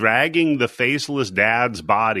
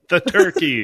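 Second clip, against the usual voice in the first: villain reveal; 13 semitones higher, 3 dB louder than usual. Acted. Villain turkey reveals himself.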